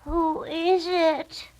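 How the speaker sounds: high, very slow frail voice; a frail old lady calling out; weak old grandmother. A narrator's reading. Grandmother calling from inside, high and frail. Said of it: "asked a weak voice".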